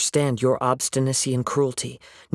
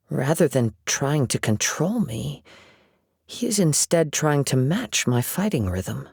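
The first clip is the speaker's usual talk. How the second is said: ordinary delivery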